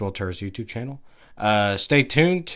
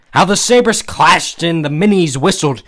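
louder, raised excited exclamation; crying out; 4 semitones higher, 7 dB louder than usual. A narrator's reading. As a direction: cry out the memory of battle. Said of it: "cried the small, shriveled-up man".

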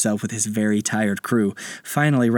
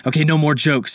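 higher, louder, cutting off the joking; impatient captain; 6 semitones higher, 4 dB louder than usual. Acted. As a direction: put an end to the nonsense. Captain loses patience with the kids.

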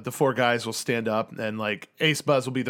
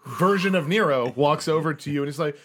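normal talking voice

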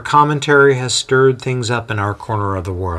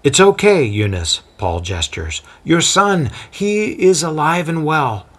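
a lightly voiced calm apostle, higher; reassuring Paul; 6 semitones higher than usual. Paul reassuring the frightened mother, lightly voiced and calm. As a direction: soothe a frightened mother gently.

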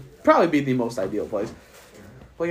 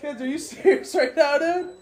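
high disbelief with a laugh inside; laughing outrage; 13 semitones higher, 3 dB louder than usual. Real, not acted. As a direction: scold her again, cracking up.

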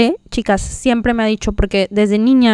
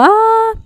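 retold shout; 11 semitones higher than usual. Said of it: "hasta yo grité".

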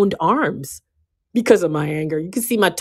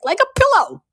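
playful squeak. Real, not acted. Playful aside about punching.

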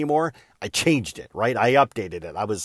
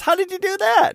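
high, loud, stunned question; shocked friend; 5 dB louder than usual. Retold or imitated. Friend over wine, stunned by my news, squeaks high and loud. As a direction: friend gasping out how, amazed. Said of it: "And he was shocked".

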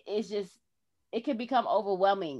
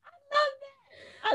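choked-up and moved; high, slow, tearful; slower than usual. Real, not acted. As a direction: voice catching with emotion. Emotional opening, cry heard.

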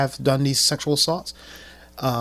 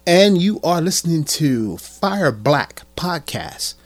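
hyped podcast host intro; 4 semitones higher, 7 dB louder than usual. Acted. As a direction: open the show pumped up. Host opening announcement.

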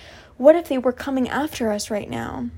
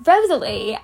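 plain reading voice

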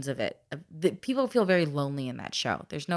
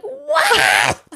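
put-on shocked kids; 8 semitones higher, 9 dB louder than usual. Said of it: "And the kids are like".

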